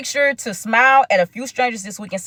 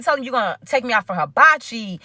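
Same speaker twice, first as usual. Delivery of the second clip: ordinary talk, nothing marked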